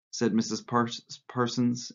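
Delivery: ordinary delivery, nothing marked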